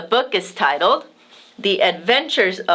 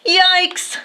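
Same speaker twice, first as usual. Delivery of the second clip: startled yikes; 10 semitones higher than usual. A narrator's reading. Storm approaching.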